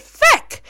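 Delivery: put-on feck in a high child's pitch, short e. A mother imitating her little daughter saying feck. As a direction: squeak out feck like a small girl. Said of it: "She said".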